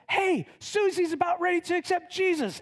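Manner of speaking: high, urgent call to the crowd; excited announcement. Acted. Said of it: "someone comes in and goes".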